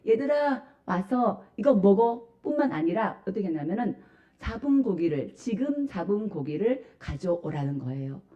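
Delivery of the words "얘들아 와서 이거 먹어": tender welcome. Retold or imitated. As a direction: voice Jesus inviting the disciples to eat. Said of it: "이제 환영을 하는데".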